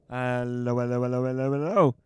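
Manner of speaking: comic British policeman greeting; policeman impression. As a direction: put on a plod copper's voice. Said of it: "That's my policeman impression".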